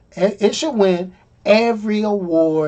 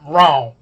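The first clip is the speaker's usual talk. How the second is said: low, flat, firmly landed wrong; flat final wrong; 4 semitones lower than usual. Shown as a demonstration. Reviewer's closing verdict on the trailer, said low and flat. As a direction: drop the Wrong down and plant it. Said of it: "Let me say it one more time".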